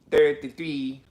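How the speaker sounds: imitating Irish, "thirty-three" as "tirty-tree"; Irish accent. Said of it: "he's got that Irish accent".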